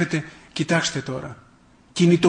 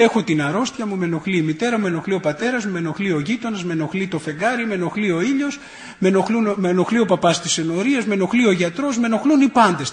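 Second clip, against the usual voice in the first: grumbling; 3 semitones higher than usual. Acted. Man complains to an elder about everyone, grumbling. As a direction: pile up each grievance in the same nagging tune. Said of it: "σταμάτα να γκρινιάζεις".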